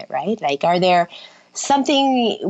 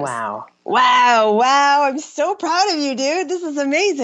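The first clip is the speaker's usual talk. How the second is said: delighted praise; 6 semitones higher, slower than usual. Real, not acted. Friend celebrates her naps.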